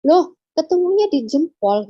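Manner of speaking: seruan heran, tinggi dan keras; dokter heran. Retold or imitated. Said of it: "yang operasi saya itu heran". (menirukan dokter yang keheranan)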